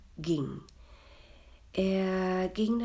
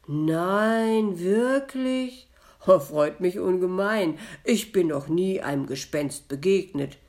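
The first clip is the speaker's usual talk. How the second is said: loud, higher exclamation of mock astonishment; feigned sympathy; 3 semitones higher, 11 dB louder than usual. A narrator's reading. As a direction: call out with put-on sympathy. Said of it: "als hätte er Mitgefühl mit dem Gespenst und rief". (gespielt mitfühlender Ausruf)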